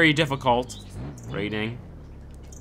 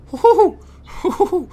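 very high, loud hooting shriek; shrieking jackpot winner; 9 dB louder than usual. Real, not acted. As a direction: shriek with giddy laughter. Shrieking laugh after tense driving.

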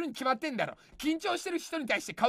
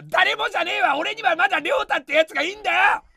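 mock rebuttal; 6 semitones higher, 6 dB louder than usual. Rebuts commenter.

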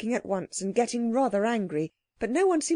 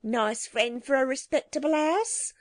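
high, huffy market woman; scornful snort; 5 semitones higher than usual. A narrator's reading. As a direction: sneer in a raised, put-on voice. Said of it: "snorted a fat woman".

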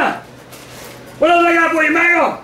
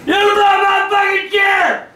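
higher-pitched outraged holler; furious yell; 4 semitones higher than usual. Real, not acted. Dad to son who broke his chair, furious yell. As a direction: explode at the culprit.

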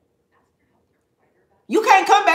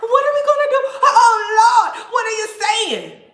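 high, loud, hysterical wailing mixed with laughter; mock frenzied panic; 10 semitones higher, 8 dB louder, slower than usual. Mocking followers in a frenzy over the burnt cards, shrieking high and loud. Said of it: "you shoulda saw them bitches in a frenzy".

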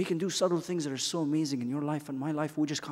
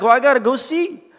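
eager Peter; 9 semitones higher, 10 dB louder than usual. Acted. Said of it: "And Peter's like".

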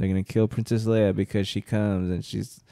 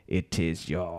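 Vader impression; 6 semitones lower, 8 dB quieter than usual. Doing Vader's voice about Luke.

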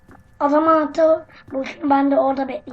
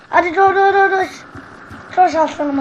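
panicked shout; 5 semitones higher, 6 dB louder than usual. Real, not acted. Panicked calling to teammate in game.

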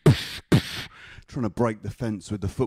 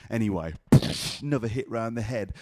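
ordinary delivery

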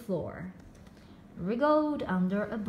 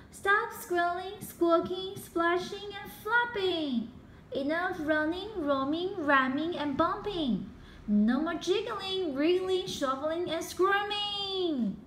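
higher, bossy rapid-fire orders; commanding list; 6 semitones higher than usual. A narrator's reading. Izzy ordering all the animals to stop, higher bossy voice. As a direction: rattle off each command firmly. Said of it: "shouted Izzy".

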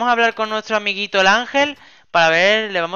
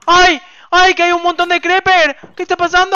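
very high, loud alarmed cries; frantic, high-pitched shouting; panicked yelling; 12 semitones higher, 10 dB louder than usual. Pretend. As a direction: scream in alarm at a sudden attack. Creepers swarm in the game.

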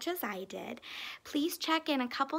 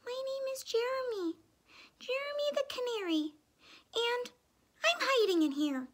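very high tiny canary voice; small high-pitched creature; little bird voice; 12 semitones higher than usual. A narrator's reading. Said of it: "a little voice spoke".

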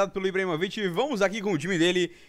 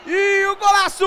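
goal shout; 12 semitones higher, 7 dB louder than usual. Real, not acted. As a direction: scream the goal. Goal celebration.

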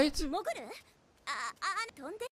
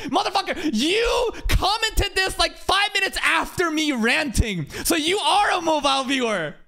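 yelling for content; 9 semitones higher than usual. Real, not acted. Streamer yells at chatter who commented late, mock-angry shouting. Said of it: "I'm just yelling for content".

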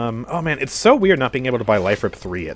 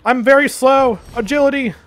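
high urgent calls; yelling while running; 12 semitones higher, 4 dB louder than usual. Real, not acted. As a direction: hurry to a teammate. Rushing to help, shouting.

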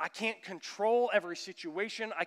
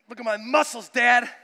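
louder, higher, show-off shout; loud proud call; 6 semitones higher, 14 dB louder than usual. Retold or imitated. Son yells to dad about his muscles, loud and proud. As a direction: kid bellowing for attention.

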